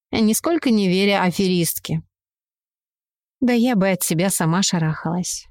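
ordinary delivery

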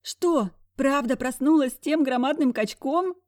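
high, loud breathless gossip. Acted. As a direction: gasp it out eagerly. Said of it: "С придыханием спросила зайка".

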